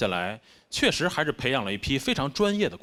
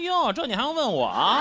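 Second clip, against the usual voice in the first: high-pitched sardonic retort of a mother; imitated Beijing mum, snidely incredulous; mock sarcastic mother; 8 semitones higher than usual. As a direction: answer as if the question is absurd. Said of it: "您觉得北京话阴阳怪气儿吗".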